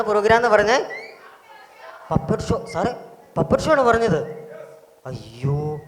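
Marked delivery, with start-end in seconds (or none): 5.1-5.8 low, soft, drawn-out groan of dismay; mock dismay. Leader pretending he was never told of the puppet show, soft mock dismay. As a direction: play at being caught unprepared.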